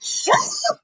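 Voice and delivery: very high, cracking squeal; mock hysterical scream. Imitating Bianca answering Liv, mock hysterical. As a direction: imitate a screech, voice breaking up. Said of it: "Screaming, hysterical".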